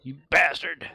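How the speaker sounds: hammed-up outrage; mock angry shout. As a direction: fake furious yell.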